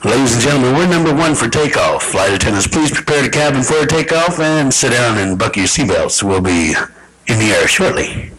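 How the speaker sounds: low, even pilot announcement; calm cabin PA read in a low, level pitch; normal pilot. Acted. Pilot telling passengers to prepare for takeoff, low and matter-of-fact. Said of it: "Here's a normal pilot, normal pilot, everyday happy pilot".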